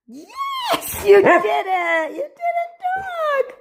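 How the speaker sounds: high, thrilled praise for the dog; excited praise. Real, not acted. Owner praises Tank for doing a dog, excited and high. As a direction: cheer him on like he just won.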